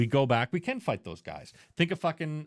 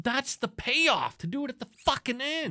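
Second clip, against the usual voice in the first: much higher, worked-up arguing; heated insistence; 12 semitones higher than usual. Real, not acted. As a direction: push the point hard, voice climbing. Heated argument about the movie.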